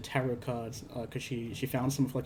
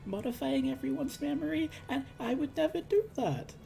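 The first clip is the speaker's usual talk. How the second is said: high, thin mock-tearful whine; pitched-up weepy protest; imitated crying; 11 semitones higher, 3 dB quieter than usual. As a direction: wail it like someone wrongly accused and in tears. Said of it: "Luna's, like, crying".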